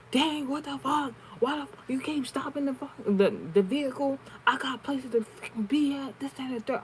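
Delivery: high, put-on voice of a belligerent rider yelling; high mocking imitation of an irate woman; imitating angry woman. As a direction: mimic the rude passenger going off on the driver. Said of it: "all I heard her say".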